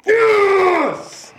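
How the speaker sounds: high-pitched celebratory cry; triumphant verdict. Real, not acted. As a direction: shriek it out in triumph. Gleeful quiz verdict reveal.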